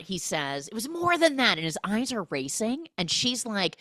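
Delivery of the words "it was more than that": high, louder repeated plea; mock impassioned insistence. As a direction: press it hard, pitched up. Retold lover repeating his plea.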